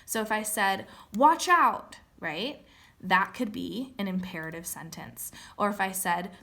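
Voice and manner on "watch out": loud, high warning call; sudden loud watch out; warning shout. Shown as a demonstration. Teacher acting out a warning.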